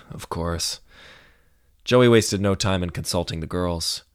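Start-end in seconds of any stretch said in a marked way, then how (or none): 0.0-0.8 audible sigh, then soft words. A narrator's reading. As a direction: sigh and give in quietly. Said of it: "Joey sighed".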